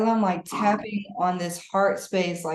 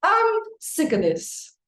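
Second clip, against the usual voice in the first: a mock childish pout; pouty sulk; 11 semitones higher than usual. Client acting out a pout moment, mock sulky. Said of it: "Just be like".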